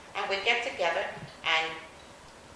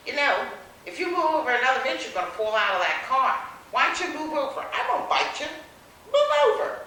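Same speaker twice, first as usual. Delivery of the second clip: higher and louder, peaking on the last words; loud teasing coax; 7 semitones higher, 7 dB louder than usual. Retold or imitated. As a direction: coax her over, rising to a loud demand. Driver coaxes her to move.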